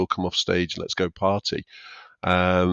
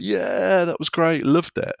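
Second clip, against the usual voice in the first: higher, imitating a buzzing comic after a gig; mock elated; 9 semitones higher, slower than usual. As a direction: playfully ham up the post-gig high. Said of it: "they come off and they're like".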